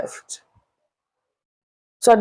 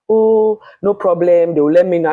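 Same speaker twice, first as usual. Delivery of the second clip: mock reply; 5 semitones higher, 6 dB louder than usual. Mocking management reply.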